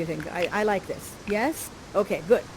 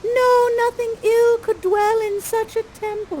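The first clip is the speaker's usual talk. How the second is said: put-on much higher, slow girlish Miranda voice; 13 semitones higher, 5 dB louder, slower than usual. Miranda pleading with her father, much higher and slow. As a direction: lift it way up and slow down, all innocent. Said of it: "And Miranda's like".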